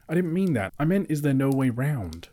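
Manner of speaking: high, fretful hobbit; anxious hobbit voice. Acted. Hobbit protests.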